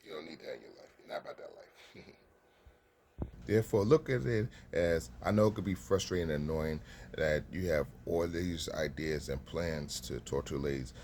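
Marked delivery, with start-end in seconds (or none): 3.5-10.9 louder, slowing to drive it home; laughing into a loud, slowed emphasis; loud emphatic push. Real, not acted. Emphatic advice after a laugh.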